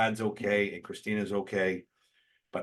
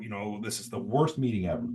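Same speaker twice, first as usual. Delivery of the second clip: ordinary talk, nothing marked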